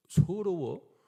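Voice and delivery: high, loud disbelieving "서러워?"; mock astonished retort; incredulous. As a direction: toss it back in disbelief. Retold incredulous question to wife.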